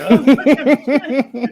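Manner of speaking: high, loud laughing outburst; bursting into laughter. Retold or imitated. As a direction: re-enact cracking up at the punchline. Acts out own burst of laughter.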